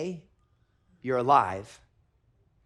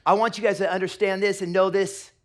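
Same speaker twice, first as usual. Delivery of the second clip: loud, raised preacher voice; preaching at full force; 5 semitones higher, 8 dB louder than usual. Acted. Pastor proclaiming loudly to the church. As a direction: drive the point home to the room.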